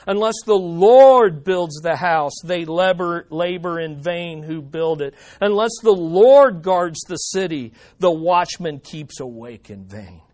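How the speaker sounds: preacher reading a psalm to the church, fervent; scripture reading. Acted. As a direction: read the psalm aloud as a heartfelt cry. Reads psalm aloud.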